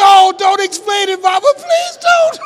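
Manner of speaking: very high, loud mortified begging; pleading shout. Retold or imitated. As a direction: beg mama to stop on live radio. Begging mother to stop on air.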